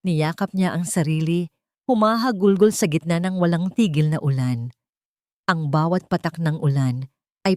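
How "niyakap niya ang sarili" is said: ordinary delivery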